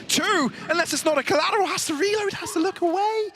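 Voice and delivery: high, urgent caster call; excited play-by-play. Acted. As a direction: build the hype as it happens. Live play-by-play.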